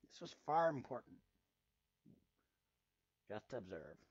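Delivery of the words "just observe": low, quiet command; a cool order from the mysterious voice; quiet commanding mystery voice. Acted. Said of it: "by the commanding tone".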